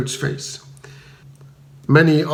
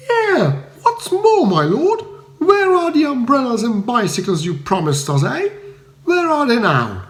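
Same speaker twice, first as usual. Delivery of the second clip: mocking stout matron; 9 semitones higher than usual. A narrator's reading. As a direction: throw his words back at him. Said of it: "interjected a stout matron".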